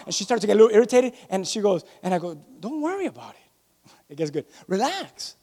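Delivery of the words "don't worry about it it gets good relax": very soft reassurance; acted-out calm husband, hushed; soothing. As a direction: quietly settle down an irritated partner. Calming his irritated wife.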